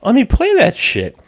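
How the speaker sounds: suddenly keen, pitch jumping up; eager retold. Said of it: "I'm like".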